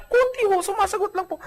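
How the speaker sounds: high, small, timid voice; timid meek voice. Acted. Meek wife answering the pastor in a small high voice. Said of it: "Ganyang kabanayad".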